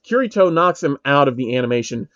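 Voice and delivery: ordinary delivery, nothing marked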